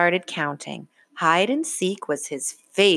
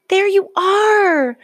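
relieved cry; 8 dB louder, slower than usual. A narrator's reading. Parents find lost child.

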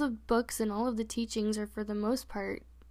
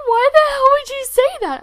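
much higher, louder indignant mom; shocked mom; 17 dB louder than usual. Retold or imitated. Mom asking her child why, high and indignant. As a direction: play the stunned mother. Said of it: "She was like".

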